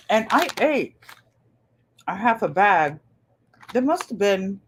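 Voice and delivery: talking while chewing; words round a mouthful of apple chips; mouth full. Real, not acted. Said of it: "I'm trying to chew with my mouth shut".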